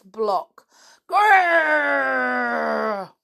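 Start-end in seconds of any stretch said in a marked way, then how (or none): 1.1-3.1 loud, high mock dog growl. Dog attacks the villains.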